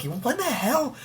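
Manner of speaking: high-pitched incredulous squawk; amused disbelief. Retold or imitated. Host recalling his laughing reaction to the odd voice, incredulous. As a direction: squeal it in baffled amusement.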